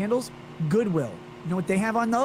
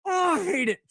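disgusted groan; 10 semitones higher than usual. Real, not acted. Disgust at buttery dish.

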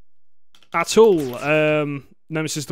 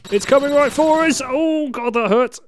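panicked scream; 8 semitones higher than usual. Real, not acted. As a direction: shout in sudden panic. Monster attacking player.

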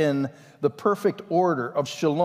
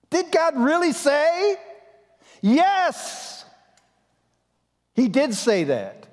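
emphatic; 12 semitones higher, 3 dB louder, slower than usual. Real, not acted. Mock question, answered emphatically.

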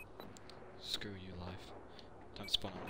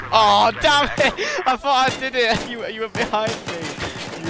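frustrated shout; 10 semitones higher, 6 dB louder than usual. Real, not acted. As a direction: yell out in frustration. Killed from behind.

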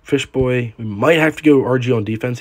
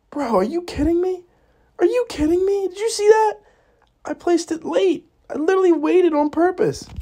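much higher outraged squeal; outraged disbelief. Real, not acted. Gamer ranting at the game after a misplay, high outraged disbelief.

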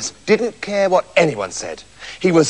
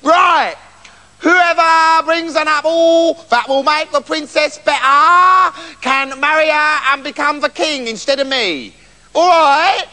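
high, drawn-out royal announcement; booming-out-the-decree king voice, pitched up; king's proclamation; 6 semitones higher, slower than usual. A narrator's reading. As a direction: proclaim it slowly and high to the whole kingdom. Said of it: "So he proclaimed".

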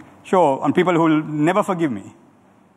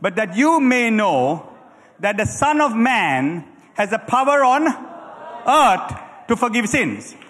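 slow scripture reading building to a shout; 3 semitones higher, slower than usual. Shown as a demonstration. Preacher leads the congregation reading the verse aloud, slow and rising to a shout. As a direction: draw it out, then cry out the last words. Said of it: "come on, say it with me".

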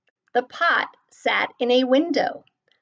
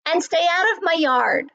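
mild storybook yell, raised pitch; moderately raised, cross voice; yelling back; 6 semitones higher, 3 dB louder than usual. A narrator's reading. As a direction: warn them off, higher and firm. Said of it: "Hank yelled back".

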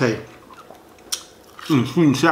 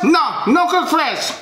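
high-pitched, curt mock attitude; high mimicked takeout worker with attitude; Chinese takeout woman; 10 semitones higher, slower than usual. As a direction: imitate a snippy counter woman refusing, pitched way up. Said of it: "that's how she talk".

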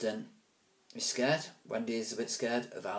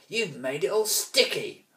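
higher, louder whining bully voice; whiny complaint; 8 semitones higher, 6 dB louder than usual. Acted. Alvis complaining to Ruskin about the sticky ball. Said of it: "Alvis complained".

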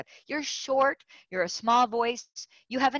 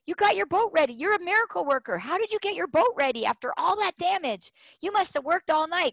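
excited urging; 8 semitones higher than usual. Retold or imitated. Sailors urging her at window.

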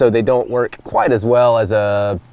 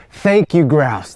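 heartfelt thanks; 3 semitones higher, slower than usual. Real, not acted. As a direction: thank it warmly with emotion. Thanking the shot grouse.

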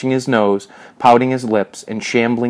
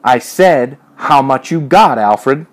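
louder, higher, insistent repeat; insistent demand; 5 semitones higher, 5 dB louder, slower than usual. A narrator's reading. Major repeats his money question to Alfred, insistent demand. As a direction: demand it forcefully, voice raised.

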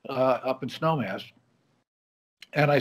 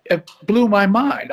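choked up; 7 semitones higher, 7 dB louder than usual. Real, not acted. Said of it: "without choking up about it".

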